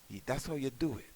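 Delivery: ordinary delivery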